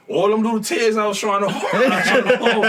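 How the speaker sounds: high, loud laughing recall; laughing through words. Real, not acted. Guest laughing about his own tears on the show. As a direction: laugh it out while admitting the tears.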